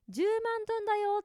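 quick, high shopkeeper line; lightly voiced Vietnamese cashier naming the bill; vendor quote. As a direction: call out the price like a street vendor. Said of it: "って言われて".

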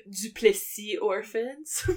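careful French pronunciation of Duplessis orphans; French accent. Shown as a demonstration. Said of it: "you said orphans with an accent".

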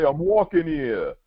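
high-pitched, indignant Italian-American New Yorker; Italian-American accent. Acted. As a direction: call it out like a Bronx Italian. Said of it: "Can't do an Italian accent".

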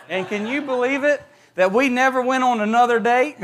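preacher laughing at his own date story. Real, not acted. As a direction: land the joke with a laugh. Punchline, laugh heard.

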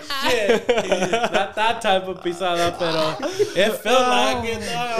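loud, high words through real laughter; cracking up. Real, not acted. Guest clarifying an accidental innuendo, helplessly laughing. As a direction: fight to speak while laughing hard. Laughing at own double meaning.

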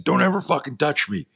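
high-pitched retold threat; angry warning. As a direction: act out a worked-up warning to a bully. Student retells warning while slamming bully.

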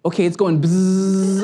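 a slow, drawn-out imitated buzz; buzzing dial tone. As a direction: hum it like a phone's dial tone. Daughter hears the dial tone.

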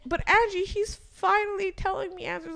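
high whiny friend voice; pleading imitation of friend; mimicking friend. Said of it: "she's like".

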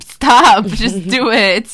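loud laughing yell; laughing shout. Real, not acted. Laughing outburst at the friend.

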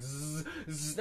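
imitated buzz of a bee. Mimicking the bee stuck in his glasses, buzzing. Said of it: "he's like".

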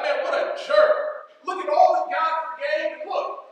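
loud, high, shouting outrage at the servant; indignant outburst. Retold or imitated. Preacher voicing his own judgment of the servant, indignant shouting. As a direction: rant at him like he's right in front of you. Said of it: "I'm like".

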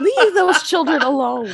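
laughing while scolding; laughing leave-them-alone; laughing plea. Real, not acted. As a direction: laugh it out, begging.

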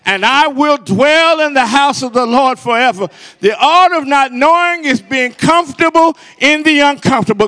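loud, high, driving preacher cadence; fervent pulpit crescendo; full-force preaching. Acted. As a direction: preach it out, building with every line.

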